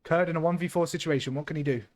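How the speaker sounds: caster's match call, a bit brighter; live play-by-play. Acted. Caster calling the round for the stream, live play-by-play.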